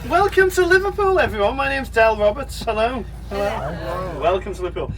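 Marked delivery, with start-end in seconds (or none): none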